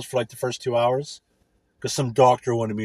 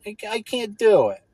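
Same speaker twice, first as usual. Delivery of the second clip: put-on whiny old lady; 11 semitones higher than usual. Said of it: "these older ladies are like".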